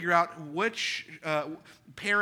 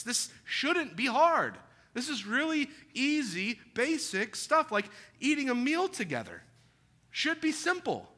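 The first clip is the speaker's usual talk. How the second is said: higher, stretched exasperation; exasperated; 6 semitones higher than usual. Real, not acted. Preacher venting to parents about simple tasks, higher exasperated voice.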